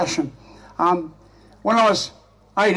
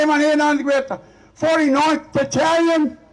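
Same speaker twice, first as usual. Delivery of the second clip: raised, high-pitched shout across a room; calling out; 8 semitones higher than usual. Retold or imitated. Young soldier asks a club full of veterans, calling out. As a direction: holler it out to a crowd.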